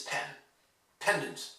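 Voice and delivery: only 'pen' left audible, then 'Pendant'; 'pen', 'Pendant' reduced to the stressed part; exaggerated stress. Shown as a demonstration. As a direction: isolate the PEN syllable, then 'Pendant' with a weak tail. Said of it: "If I exaggerated".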